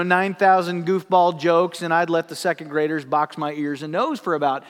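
normal talking voice

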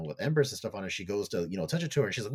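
usual speaking voice

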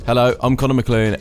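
normal talking voice

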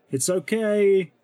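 loud, slow, high public announcement; big show. Acted. Timur loudly reassures the crowd, putting on a big show. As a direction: make a loud show of it for everyone. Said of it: "big show of saying".

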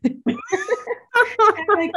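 laughing high through the words. Real, not acted. Mocking her own bravery, laughing high. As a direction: giggle it out.

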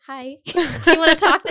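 giggling through a shy question; a laughing, awkward little hello; laughing mock guest. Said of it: "they would be like".

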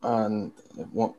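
soft, halting mumble; mumbling. Real, not acted. Co-host stalls as his segment begins, mumbling. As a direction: mumble through a false start, trailing away. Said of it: "Why are you mumbling?".

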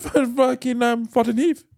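put-on high, hesitant Indian-accented answer; hammed-up Indian English; mock Indian accent. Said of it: "an Indian twang".

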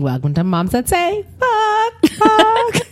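drawn-out greeting rising to a squealed high Fuck; sing-song show welcome. Acted. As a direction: welcome everyone like a giddy show host. Host welcomes listeners, show name.

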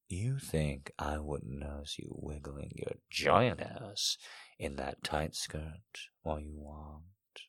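low, hushed man's voice, slowing down; teasing male lover. A narrator's reading. Man to his lover in an erotic story, low teasing murmur. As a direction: drop low and very soft, teasing.

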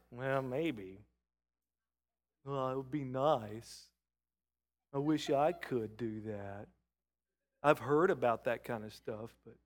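a hint of a hesitant doubter, slowed; doubtful inner voice. Preacher voicing a doubting believer, mildly hesitant. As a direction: mumble it like a half-convinced skeptic.

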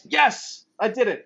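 high, excited self-cheer; triumphant. Real, not acted. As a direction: celebrate getting it right. Got the tag-team name right.

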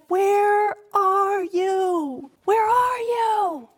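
long, drawn-out high call; God calling out. Acted. God calling for the hiding man, long high searching call. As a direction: call out slowly across the garden, searching. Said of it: "the Lord God called to the man and said".

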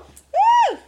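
very high, loud "woo"; squealed mock spring break cheer; party whoop. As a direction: whoop it out at full pitch.

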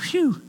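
high, falling whew of relief; exaggerated sigh of relief; relieved whew. Preacher voicing congregation's relief at no snake handling, playful whew.

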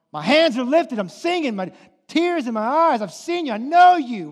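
loud, high, fired-up preaching; full-force preaching. Acted. Preacher fired up, driving home that Jesus disrupts life. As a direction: preach it out at the congregation.

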